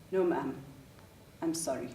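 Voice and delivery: subdued, careful apology; soft-spoken. Retold or imitated. Older officer answering the mother, kind and soft-spoken. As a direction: let it drop softly at the apology. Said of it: "soft-spoken".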